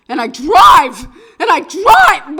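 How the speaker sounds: pressing the pedal harder with every word; frantic building shout. Retold or imitated. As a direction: push it up to a full shout. Escalating frantic driving.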